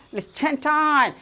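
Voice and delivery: high mock English-American accent, clipped-off final t; imitated English-American speaker. Teacher repeating what the foreigner said, imitated English-American accent. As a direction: mimic the foreigner's 'chain tight'. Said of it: "इस तरह के कहा".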